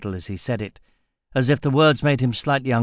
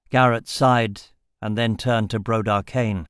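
plain reading voice

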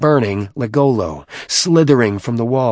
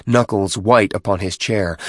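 plain reading voice

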